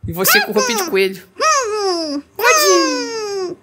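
high excited coelho; delighted high-pitched squeal. Real, not acted. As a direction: squeal at the cute outfit. Squeal over bunny outfit.

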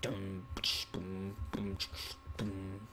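very soft, low mouthed thumps; beatbox quietly under your breath; vocal bass drops. Pretend. Player fakes his sound system, hushed low beatbox. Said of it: "the sound of me dropping the bass".

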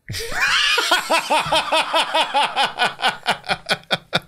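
long, high peal of laughter; real laughing fit. Real, not acted. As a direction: burst out laughing and keep going. Listener laughs at failed joke.

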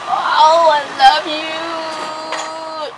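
slow, soft and tender. Real, not acted. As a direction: melt into it, drawn out. Hugging her toddler.

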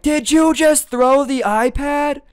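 put-on a mother catching her kid mid-crime, loud and appalled; shocked scolding. Mom shouting up the ladder at her son, shocked scolding.

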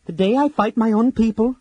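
loud, high vow; swearing aloud. A narrator's reading. Laurana alone in the cave swears a loud, high oath. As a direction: fling the oath out defiantly. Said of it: "she swore aloud".

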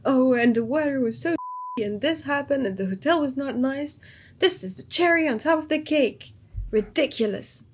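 lightly voiced moaning tourist; complaining French tourist. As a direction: imitate a grumbling holidaymaker. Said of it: "it'd be like".

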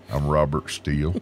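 low, drawling cowboy. Pretend. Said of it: "Robert Steele sounds like a, a cowboy name".

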